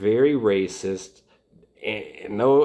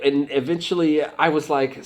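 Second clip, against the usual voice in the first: usual speaking voice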